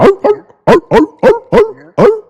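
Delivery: high yappy barks; imitated barking. Retelling the wolf child's barking, high yappy dog barks. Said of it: "started barking".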